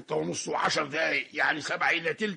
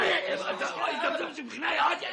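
heated retort; 8 semitones higher than usual. Acted. Street quarrel.

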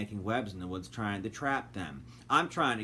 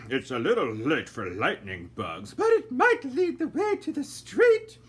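put-on high, slow, prim old lady voice; a genteel lady fretting in the dark; voiced lady character; fussy lady; 9 semitones higher, 6 dB louder than usual. A narrator's reading. Lady Dandelion voiced in story.

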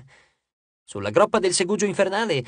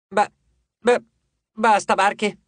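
stammered grumble; 4 semitones higher, 3 dB louder, slower than usual. A narrator's reading. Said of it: "borbottò l'arpia".

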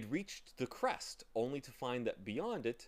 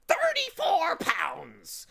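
long-drawn, high, loud shriek; bird shriek; 12 dB louder, much slower than usual. A narrator's reading. Bird disappearing into the fog, drawn-out shriek. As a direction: shriek it slowly like a departing bird. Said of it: "shrieked the bird as he disappeared".

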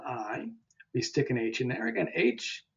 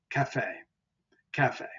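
cafe as ca-FEH, weight on the end; last syllable stressed. Shown as a demonstration. Teacher showing the accent mark, cafe stressed on its last syllable. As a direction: put the accent on the final e of cafe. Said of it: "when the last syllable is accented".

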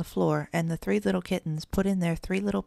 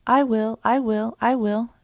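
louder, higher eager volunteering; eager cry; 4 semitones higher, 6 dB louder than usual. A narrator's reading. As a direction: shout it out, keen to help. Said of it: "cried the three little kittens together".

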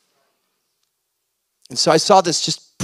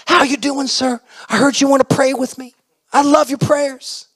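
put-on high, loud voice of a sweet elderly man; sweet old man; 13 semitones higher, 5 dB louder than usual. Anderson welcomes the visitor who came to pray, high sweet old-man voice. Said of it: "the sweetest man I've ever met".

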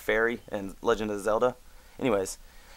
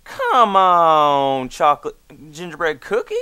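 higher and louder, incredulous come on; exasperated disbelief; 6 semitones higher, 10 dB louder than usual. Real, not acted. Griping about the gingerbread cookie, exasperated and louder. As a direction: plead in outraged disbelief.

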